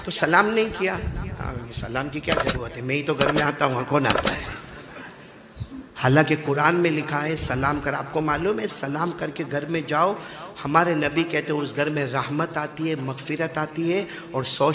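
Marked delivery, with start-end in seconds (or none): none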